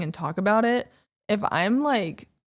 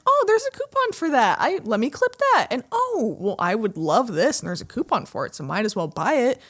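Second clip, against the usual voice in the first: excited; 4 semitones higher than usual. Retold or imitated. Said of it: "I'm like".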